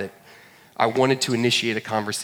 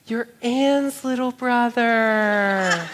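much higher, very slow doting voice; cooing tone; 9 semitones higher, much slower than usual. Retold or imitated. Sorority girl realizes he is Ann's brother, cooing high and slow. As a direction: coo it like spotting a cute baby. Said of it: "the tone of voice changed".

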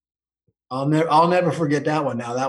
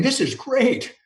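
higher, excited inner voice; a kid thrilled the cows stayed up in the draws; delighted he can skip the work; gleeful thinking; 6 semitones higher than usual. Retold or imitated. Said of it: "So I'm thinking".

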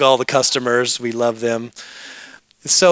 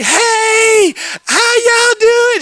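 high, loud, drawn-out gushing hello; hammed-up squealing welcome, stretched long; over-the-top greeting; 5 dB louder, much slower than usual. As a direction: shriek a greeting like long-lost family. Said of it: "a greeting ramped way too high".

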